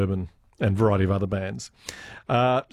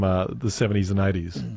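usual speaking voice

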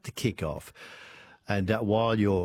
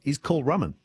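ordinary talk, nothing marked

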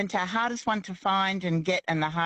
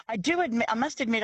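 ordinary talk, nothing marked

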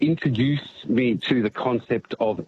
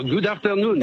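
playful put-on French accent, higher; 6 semitones higher than usual. Caller obliging the radio host's request, in a French accent. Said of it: "say it in a French accent".

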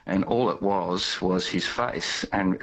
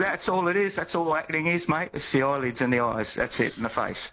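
lightly imitated Caine accent, higher pitched; Michael Caine accent; 8 semitones higher than usual. Guest quotes Caine's acting lesson, light Caine accent. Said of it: "That's my Michael Caine accent".

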